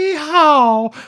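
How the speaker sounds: high, loud cowboy whoop; cowboy yeehaw. Acted. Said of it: "Oh, I did that well".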